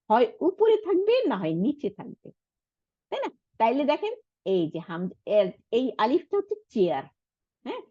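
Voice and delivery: usual speaking voice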